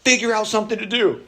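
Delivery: high, loud sarcastic good; dad answering the bored child's complaint with a sarcastic good; mock-cheerful retort. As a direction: bounce it back at the bored kid brightly. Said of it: "I'm like".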